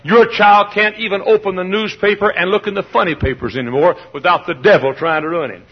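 ordinary talk

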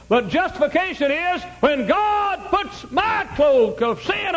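high, ringing preacher's cry, drawn out; revival-tent climax, high and loud; preaching at full force. Real, not acted. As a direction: lift the whole room with it, slow and soaring.

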